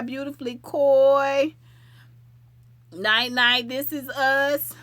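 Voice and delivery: usual speaking voice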